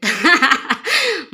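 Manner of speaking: loud high burst of laughter. Real, not acted. Laughs about getting hurt. (malakas na tawa)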